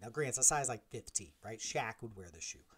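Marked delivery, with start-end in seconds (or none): none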